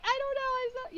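high sheepish apology; apologetic. Retold or imitated. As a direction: fumble the words, all apologies. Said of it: "I was just so apologetic".

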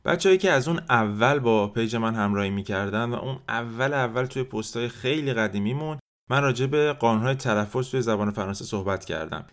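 ordinary talk, nothing marked

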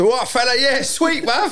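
mock London slang. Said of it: "So if, if they say".

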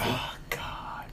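breathy whispered gasp. Real, not acted. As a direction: whisper it in dismay. Reacting in disbelief.

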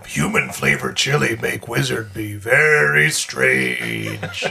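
dumb brute voice. Acted. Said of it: "I'm gonna lean over to Robble and go".

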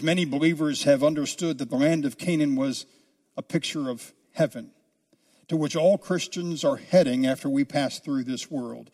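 ordinary talk, nothing marked

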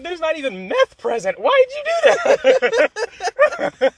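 high, loud, very slow pleading wail; incredulous. Retold or imitated. Asking her why she did that: high, drawn-out, incredulous wail. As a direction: stretch out the why in disbelief.

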